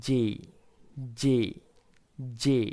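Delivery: জে said as "jay", ending on a gliding "ay"; জে stressed, drawn out like "jay"; correct letter J. Shown as a demonstration. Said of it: "সঠিক উচ্চারণ হবে".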